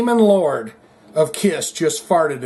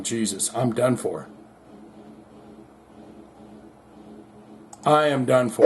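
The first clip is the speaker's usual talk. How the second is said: soft, low, defeated mutter; despairing Ronald; 5 semitones lower, 5 dB quieter than usual. Retold or imitated. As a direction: mumble like it's all over. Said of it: "just going".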